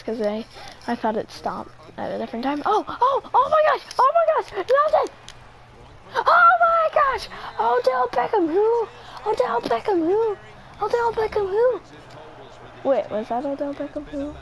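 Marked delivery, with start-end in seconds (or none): 2.7-11.8 high-pitched, ecstatic celebration of a catch; real high-voiced gamer hype; thrilled outcry. Real, not acted. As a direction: squeal it out as the catch lands.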